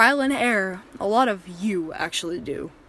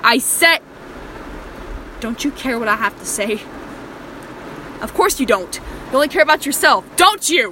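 louder and higher, stress on you; angry shouting; 4 semitones higher, 6 dB louder than usual. Acted. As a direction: repeat it as a furious demand. Captor repeating, escalating rant.

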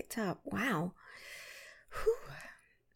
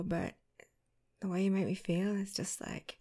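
ordinary delivery

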